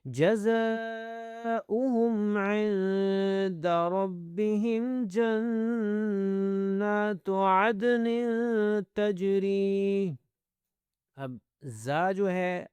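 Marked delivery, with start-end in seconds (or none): 0.0-10.1 slow, drawn-out tajweed chant; measured teaching recitation, slightly raised pitch; Quran recitation. Shown as a demonstration. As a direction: recite with long held vowels.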